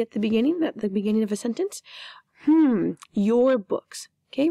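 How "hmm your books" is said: mock puzzled reading. Shown as a demonstration. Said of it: "remember this stands for "you are".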